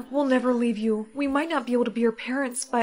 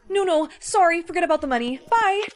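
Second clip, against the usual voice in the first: louder, higher flustered backing off; panicked retreat; 4 semitones higher, 6 dB louder than usual. Acted. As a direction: scramble away in fright. Scared bully backs off.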